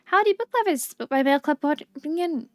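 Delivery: announcer read. Acted. Reading a radio-style mail message.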